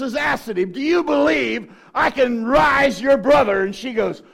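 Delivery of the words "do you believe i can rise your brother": high, loud and slow; slow, loud question. Retold or imitated. Preacher voicing Jesus asking grieving sisters, slow loud. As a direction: press the question home.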